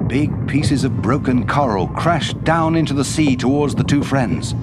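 plain reading voice

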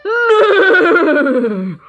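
loud, high whinny falling in pitch; pony neigh. Acted. Ponies stop in surprise before the stoat, whinnying loudly. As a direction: let out a long startled horse neigh.

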